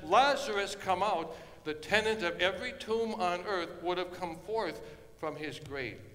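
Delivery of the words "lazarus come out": call out. Retold or imitated. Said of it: "if he hadn't said".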